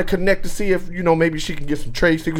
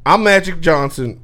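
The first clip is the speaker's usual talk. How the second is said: ordinary talk, nothing marked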